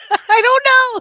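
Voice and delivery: laughing high and loud; nervous giggle before performing. Real, not acted. Laughing before zombie impression.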